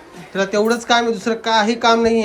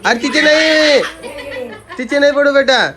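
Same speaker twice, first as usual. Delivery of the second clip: laughing protest; 7 semitones higher, 6 dB louder than usual. Real, not acted. Stopping child touching baby's feet.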